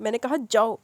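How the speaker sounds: loud angry order to leave; shouting. Acted. Haya orders the sisters out, loud shout. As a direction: bark the command, loud. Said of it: "وہ چلائی".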